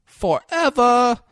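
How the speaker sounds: high, slow mock echo. Real, not acted. Mocking a friend's word choice. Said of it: "mocking friend's word".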